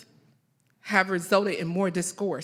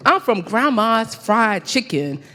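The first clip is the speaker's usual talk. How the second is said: loud, emphatic live poetry recital with measured pauses; spoken-word poem; 3 semitones higher, 6 dB louder than usual. Acted. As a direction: declaim it line by line, letting each phrase land.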